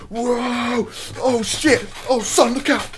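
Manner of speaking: high, loud mock-excited yelling; dad getting into it. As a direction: imitate an excited dad yelping at a game. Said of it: "my dad was getting proper into it".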